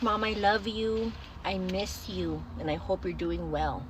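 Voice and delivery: slow, soft, drawn-out sincerity; tender. Retold or imitated. Daughter texting her mom, this is her chance: slow, tender.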